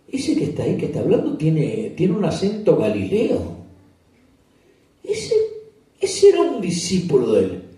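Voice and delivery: high, loud, pointed accusing voice; accusing woman. Acted. A woman in the courtyard accuses Peter, high and loud. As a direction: point him out to everyone in a high voice.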